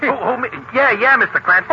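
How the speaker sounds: sounding like he has a cold; stuffed-up cold voice. Acted. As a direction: talk through a blocked nose. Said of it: "Have you got a cold?".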